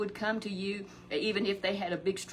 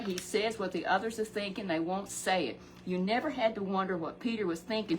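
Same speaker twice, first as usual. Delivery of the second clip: ordinary delivery, nothing marked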